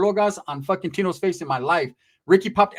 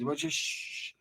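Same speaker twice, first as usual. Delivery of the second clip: urgent hushing; 12 dB quieter, much slower than usual. Retold or imitated. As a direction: drop right down into a shush. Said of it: "Don't say it loud".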